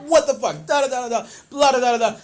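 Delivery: loud, high-pitched tirade trailing into nonsense syllables; hammed-up angry rant; mock cursing. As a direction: imitate a tough guy blowing up. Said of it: "He starts cursing at me".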